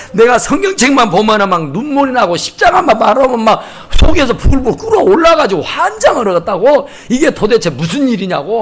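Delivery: worked-up, agitated mock complaint; making a fuss. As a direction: imitate an overwhelmed man protesting to his pastor. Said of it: "막 목사님한테 난리를 하는 거야". (목사에게 난리 치며 하소연하는 흉내)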